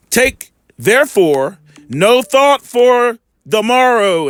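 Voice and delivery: very slow, drawn-out reading of the verse; scripture reading. Acted. Preacher reading the verse aloud, slow and drawn out. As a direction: stretch the words out, letting each one land.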